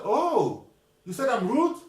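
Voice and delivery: indignant disbelief. Retold or imitated. Clerk bristling at the applicant who called her rude, indignant outcry. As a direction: rise up in offended disbelief.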